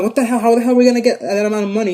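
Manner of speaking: acted outburst of disbelief; incredulous protest. Family protests impossible demand.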